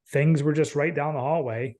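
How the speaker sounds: usual speaking voice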